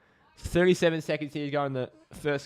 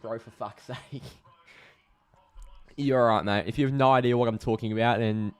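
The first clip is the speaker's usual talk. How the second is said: ordinary talk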